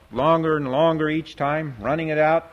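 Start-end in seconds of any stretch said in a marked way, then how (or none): none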